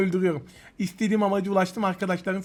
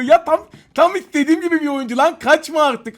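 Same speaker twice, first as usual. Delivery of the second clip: thrilled, loud and high while chasing; hyped yelling at the screen; excited shout; 6 semitones higher, 6 dB louder than usual. Real, not acted. Gamer chasing a rival player, excited shout.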